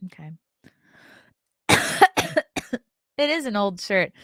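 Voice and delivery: normal talking voice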